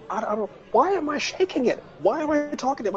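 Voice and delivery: high, rattled self-questioning; nervous panic. Retold or imitated. Retelling the dildo moment to the son, high nervous panic. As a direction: sound caught out and flustered. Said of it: "so nervous".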